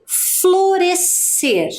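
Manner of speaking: "florescer" drawn out very slowly and high; slow emphasized word. Shown as a demonstration. Teacher posing the word to the class, stretched. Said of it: "Qual a maneira correta de separar".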